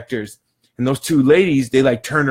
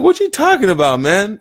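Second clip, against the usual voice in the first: much higher and louder, incredulous; 8 semitones higher, 5 dB louder than usual. Retold or imitated. Answering the accusing shopper, high and loud in disbelief. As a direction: call out in baffled disbelief.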